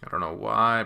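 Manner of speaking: plain reading voice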